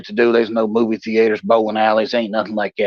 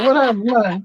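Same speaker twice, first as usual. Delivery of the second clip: a hint of feigned innocence; mock innocence; 11 semitones higher than usual. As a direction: play dumb. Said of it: "I'm like".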